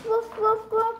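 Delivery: playful woof woof barks; dog barking. Pretend. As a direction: bark like a puppy.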